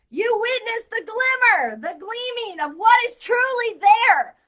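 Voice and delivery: high, loud rapturous delivery; ecstatic reading. Real, not acted. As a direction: preach it with wild excitement.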